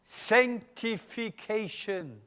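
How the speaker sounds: Sanctification stretched slowly with a falling end; slow emphatic word. Shown as a demonstration. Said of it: "Have you heard this word before?".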